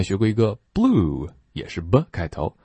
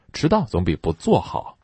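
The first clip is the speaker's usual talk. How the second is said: ordinary talk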